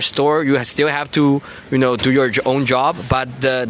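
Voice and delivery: ordinary talk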